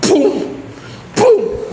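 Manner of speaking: high, booming knock sounds; imitated banging. Imitating the guy banging on the basement wall, booming. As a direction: pound out the booms like fists on a wall.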